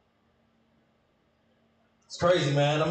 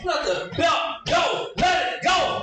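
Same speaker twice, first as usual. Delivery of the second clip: mock mama yelling; 9 semitones higher, slower than usual. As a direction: yell at him like an angry mama.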